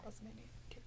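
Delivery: soft, rising whisper of the answer; whispered echo. Real, not acted. Host whispers the trivia clue again to herself. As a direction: whisper the name back to yourself.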